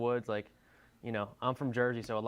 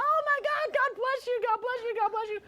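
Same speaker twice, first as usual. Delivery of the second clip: put-on very high, loud, gushing girl's voice; sky-high overwhelmed gushing; drunk crying girl; 5 dB louder than usual. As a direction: squeal out thanks like the tearful drunk girl. Drunk crying girl thanking them.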